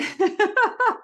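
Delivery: high helpless giggle; real burst of high laughter; laughing. Real, not acted. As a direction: laugh despite yourself. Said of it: "I shouldn't be laughing".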